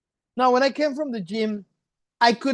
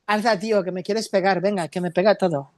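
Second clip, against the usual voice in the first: put-on Spain Spanish accent; faster than usual. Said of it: "you mean Spanish from Spain".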